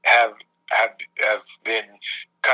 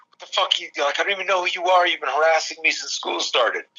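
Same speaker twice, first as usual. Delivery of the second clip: higher-pitched, exasperated protest; mock outrage, pitched up without shouting; retold indignant outburst; 7 semitones higher than usual. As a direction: act out fed-up indignation at a bully.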